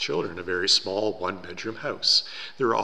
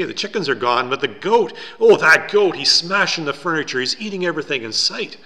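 loud, high, exasperated outburst; frantic complaint; 8 semitones higher, 10 dB louder than usual. A narrator's reading. Poor man hurrying back to complain about the goat, frantic. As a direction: build to an exclamation, then keep it agitated.

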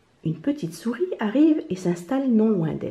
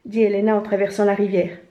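ordinary delivery

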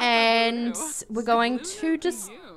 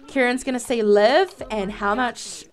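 ordinary delivery

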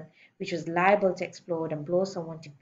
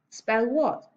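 raised-pitch, firm spelling order; headmistress's command; 5 semitones higher, 4 dB louder than usual. Acted. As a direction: set the pupil the word briskly. Headmistress sets pupil a spelling test.

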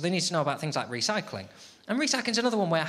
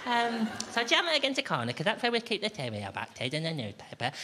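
put-on high, sugary voice explaining to a small child; mock-patient nursery voice for the housemates; sing-song voice for housemates; 6 semitones higher than usual. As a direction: talk down to them like a nursery teacher. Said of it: "Why are you talking like that".

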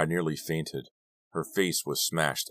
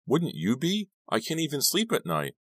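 much higher-pitched, exasperated protest; 11 semitones higher than usual. A narrator's reading. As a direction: let exasperation push the pitch right up. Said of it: "I was letting my exasperation show".

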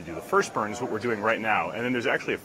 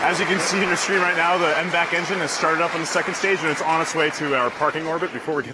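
louder, higher, eager announcing; excited; 6 semitones higher, 6 dB louder than usual. Real, not acted. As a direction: ride the excitement of the launch. Host thrilled at engine start.

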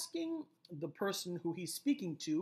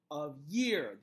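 of YEAR, year louder and drawn out; stress on year; 2 semitones lower, 3 dB louder than usual. Shown as a demonstration. Teacher marking the second iamb, stress on year. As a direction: pass lightly over of, land on year. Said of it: "the emphasis is on a year".

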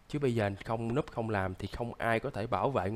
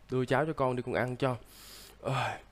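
usual speaking voice

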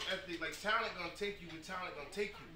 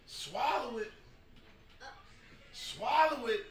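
firm command building louder; 3 semitones higher, slower than usual. Retold or imitated. Coach tells crying player to swallow it, building to loud. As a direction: Swallow it, getting firmer and louder each time. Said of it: "all I said to him was".

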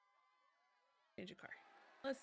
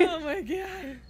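after laughing hard; 6 semitones higher, 10 dB louder, much slower than usual. Real, not acted. Right after a big shouted laugh.

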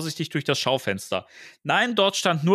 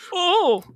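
high, loud exclamation; yelped oh; 4 dB louder than usual. Acted. Louis hit by falling rubble, high cry.

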